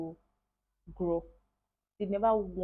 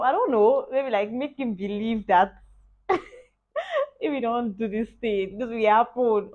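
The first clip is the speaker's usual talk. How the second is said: laughing; 4 semitones higher, 10 dB louder, slower than usual. Real, not acted. Laughing explanation of God's plan.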